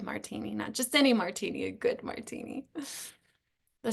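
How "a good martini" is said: soft whisper, savoring each word. Real, not acted. Host savoring a favorite drink, whispered. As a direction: breathe it like a treat you love.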